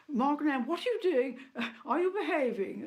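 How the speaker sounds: a sister scolding Margaret in a hammed-up high voice; imitating the Queen. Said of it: "I can remember the Queen saying".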